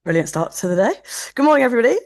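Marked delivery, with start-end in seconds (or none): 1.3-2.0 bright, loud, high-pitched hello; excited greeting. Real, not acted. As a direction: greet them with a burst of energy. Cheerful greeting opening her talk.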